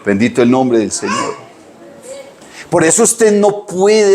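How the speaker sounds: normal talking voice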